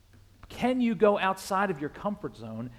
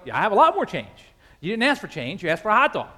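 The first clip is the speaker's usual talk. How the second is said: firm retort; 4 semitones higher, 8 dB louder, faster than usual. Retold or imitated. Retorts to beggar.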